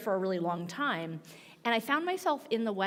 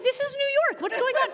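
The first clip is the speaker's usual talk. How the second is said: incredulous; 13 semitones higher, 6 dB louder, faster than usual. Retold or imitated. Shocked retold reaction.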